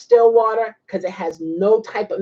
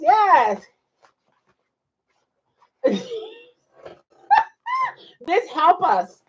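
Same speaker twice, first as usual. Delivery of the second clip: usual speaking voice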